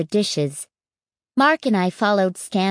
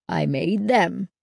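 proud grin. A narrator's reading. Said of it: "grinning proudly".

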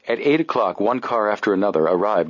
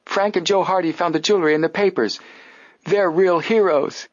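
put-on high, excited girlish cheer; excited girls shouting; 11 semitones higher than usual. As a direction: shout it out in delight.

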